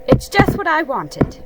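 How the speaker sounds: pleased Rabbit. A narrator's reading. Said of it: "he said".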